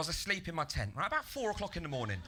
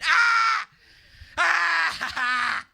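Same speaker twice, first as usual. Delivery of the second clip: high, drawn-out acted scream; really loud scream; 12 semitones higher, 11 dB louder than usual. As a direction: scream out at full volume. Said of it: "Really loud screaming".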